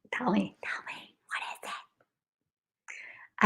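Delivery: whispered, eager plea; very soft, drawn-out whispered question. Pretend. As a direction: beg for the secret in a whisper.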